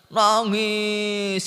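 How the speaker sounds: "Nangis" high and very slowly stretched; drawn-out word. A narrator's reading. Preacher tells listeners the stone kept weeping, "Nangis" long drawn out. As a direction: hold the vowels of "Nangis" long.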